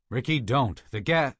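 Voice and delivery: loud, cut-off shout; urgent warning. Acted. Peters stopping Burgess lighting a flare, urgent shout. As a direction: shout to stop him before it's too late.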